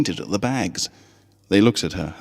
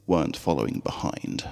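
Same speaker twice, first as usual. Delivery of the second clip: usual speaking voice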